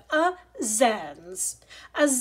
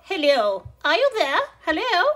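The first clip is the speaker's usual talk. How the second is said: calling out; 4 semitones higher, 6 dB louder, faster than usual. Acted. Calling on the phone.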